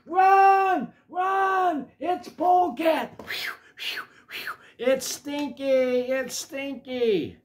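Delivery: high, loud cries to flee; alarmed shouting. A narrator's reading. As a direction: shout the warning in mock panic, drawing it out. Characters flee Polecat in alarm.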